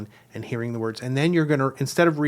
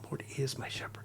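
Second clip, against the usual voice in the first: hushed, much softer, with weight on "is"; musing to oneself; 15 dB quieter than usual. Shown as a demonstration. Teacher modelling inner meditation, hushed musing. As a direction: murmur it inwardly, savoring "is". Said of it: "I'm gonna say it in my mind like this".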